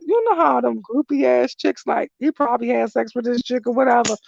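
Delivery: ordinary delivery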